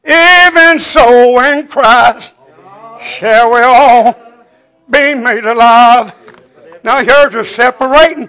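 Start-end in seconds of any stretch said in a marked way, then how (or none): 0.0-6.1 slow, half-sung scripture whoop; preaching at full force. Acted. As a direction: chant the verse like a climax of the sermon. Preacher whoops scripture over music.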